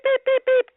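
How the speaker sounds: high-pitched electronic beep imitation; droid beeps. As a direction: squeal out robot beeps.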